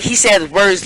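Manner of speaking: plain reading voice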